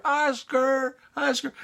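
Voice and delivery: very high calling voice; high mock search call; searchers calling out. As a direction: call for a lost child, high-pitched. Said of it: "they were looking all over the place for Oscar".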